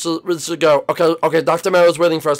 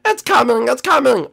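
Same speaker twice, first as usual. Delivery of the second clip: high, urgent cry; hammed-up panic, pitched high; play-acted alarm; 7 semitones higher, 3 dB louder than usual. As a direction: sound the alarm, half in play.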